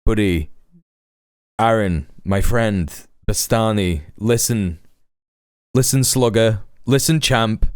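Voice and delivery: low, patronizing buddy talk; mock consoling. Streamer pretends to counsel commentator about his brain, mock consoling. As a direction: break bad news with mock patience.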